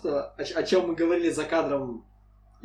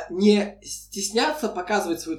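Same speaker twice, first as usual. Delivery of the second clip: usual speaking voice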